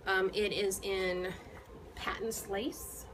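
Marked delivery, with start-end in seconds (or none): none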